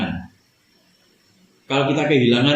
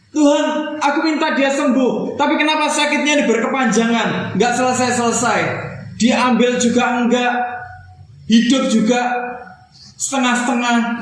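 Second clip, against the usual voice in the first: anguished plea; 10 semitones higher than usual. Acted. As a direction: beg God for healing, voice strained high. Voicing a pleading grieving believer. (rintihan memohon, tinggi dan lambat)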